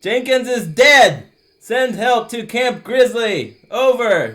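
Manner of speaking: shouted repeat. Acted. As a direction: yell it so they finally hear.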